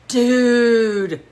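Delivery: high, loud hyped-up call; a stoned bandmate bursting with news; excited. A narrator's reading. Bandmate bursts in to the pianist, hyped "Dude". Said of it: "Gage said".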